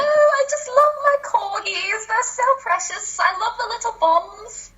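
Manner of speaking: high, fluting British accent; put-on British, delicate and adoring; Queen of England. Shown as a demonstration. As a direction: gush in exaggerated regal British English. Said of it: "I immediately think Queen of England".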